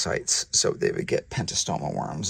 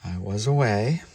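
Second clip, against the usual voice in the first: usual speaking voice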